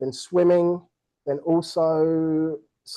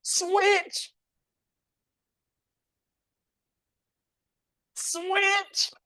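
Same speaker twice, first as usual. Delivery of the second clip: high shouted command over the room; calling out; 4 dB quieter than usual. Real, not acted. Host calls the switch to the racers, high shout. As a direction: bark the change of move.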